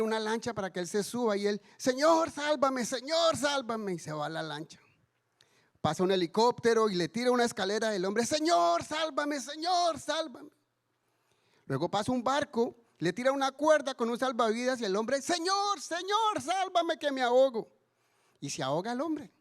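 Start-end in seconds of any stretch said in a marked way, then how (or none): 1.8-3.9 high, pleading shout of a drowning man; panicked plea, voice up high; desperate cry for help. Acted. Drowning man cries to God. 8.3-10.5 high, pleading shout of a drowning man; panicked plea, voice up high; desperate cry for help. Acted. Drowning man cries again. 15.2-17.7 very high, desperate pleading; last gasp of a drowning man; frantic cry for help. Acted.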